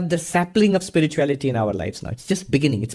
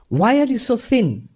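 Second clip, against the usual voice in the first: concerned guru; 9 semitones higher than usual. Acted. Guru questions the snake.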